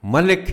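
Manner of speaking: ملک said in two syllables, "ma-lik", high and loud. Shown as a demonstration. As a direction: say ملک with short a after m. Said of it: "یہ ایک ذات".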